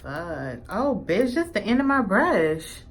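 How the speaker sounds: ordinary delivery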